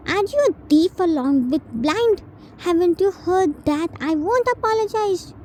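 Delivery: high, mocking child voice; bratty taunt. T taunting Jin, high and mocking. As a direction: taunt him with a sneer.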